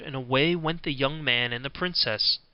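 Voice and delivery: ordinary delivery, nothing marked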